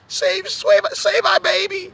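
high-pitched cartoon cry for help; Olive Oyl. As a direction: wail for rescue in a very high voice. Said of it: "Popeye is olive oil".

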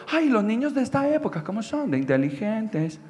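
lightly mocked doting mother; hint of gushing mothers. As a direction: start high, then let it drop, soft and admiring. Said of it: "Cuando uno escucha a los mamaces de ahorita".

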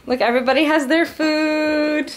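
happy host pointing out the food; excited. Real, not acted. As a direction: show off the food with glee. Delighted at the food.

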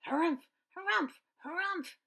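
high, loud squawking harumph; bird yelling. A narrator's reading. Bonga bird furious at squirrels, high loud squawk. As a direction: puff up and bellow like a cross bird. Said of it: "yelled a bonga bird".

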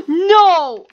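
loud, high cry of protest; dismayed shout. Real, not acted. Gamer seeing the enemy looking back, dismayed shout. Spotted and killed in game.

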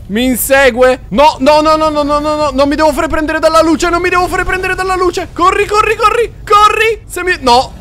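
panicked high shouting; being chased. Real, not acted. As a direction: scream it while fleeing for life. Inseguito dal mostro. (grida in panico mentre scappa)

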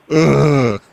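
ordinary talk, nothing marked

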